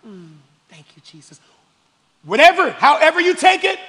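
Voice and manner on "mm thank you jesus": very soft, low murmur; inward thanks. Acted. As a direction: barely voice it, as a private prayer. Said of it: "inwardly just like".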